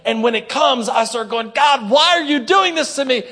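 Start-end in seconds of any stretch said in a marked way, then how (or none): 1.5-3.2 mock anguished whine, higher and louder; hammed-up self-pity; whiny cry to God. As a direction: wail at God like a victim. Mock complaining prayer.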